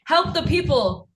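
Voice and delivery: high, mild mock yell; half-voiced urgent shout; retold scream. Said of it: "But Runa was screaming".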